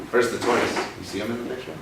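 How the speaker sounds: plain reading voice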